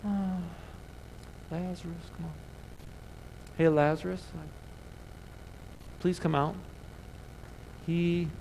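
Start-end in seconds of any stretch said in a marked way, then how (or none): 0.0-6.5 soft, limp, unsure calling out; timid mock call. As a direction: call him out meekly, like you don't mean it. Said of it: "Jesus didn't walk up that tomb like".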